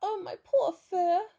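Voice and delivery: high, loud, slow wail; gasping lament. A narrator's reading. Teddy dragged through a prickly hedge, gasping wail. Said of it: "gasped Teddy".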